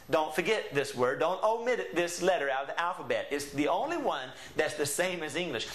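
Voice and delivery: usual speaking voice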